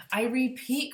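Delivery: I repeat cried out loud and high; crying out. Acted. Lorax insisting to the busy Onceler, cried out. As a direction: cry it out insistently. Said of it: "cried the Lorax".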